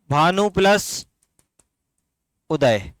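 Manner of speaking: Bhanu plus उदय split slowly, words held apart; slow sandhi split. Shown as a demonstration. Teacher to students, slowly splitting the word for them. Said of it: "very good".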